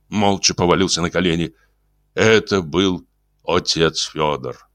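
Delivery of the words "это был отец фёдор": ordinary delivery, nothing marked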